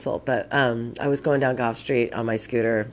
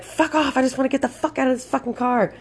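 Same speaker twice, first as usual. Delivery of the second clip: high-pitched, exasperated retold yell; angry retort; 12 semitones higher than usual. Fleeing rider tells off the panicking passenger, angry and high. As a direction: snap back fast, pitched way up.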